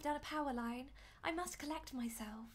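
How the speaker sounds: put-on game character read in a British accent; composed British accent. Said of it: "When I do a British accent like this".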